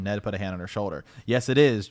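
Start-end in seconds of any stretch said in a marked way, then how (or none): 1.2-1.9 loud, insistent reply; insisting. A narrator's reading. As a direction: insist on it loudly. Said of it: "Joffrey insisted".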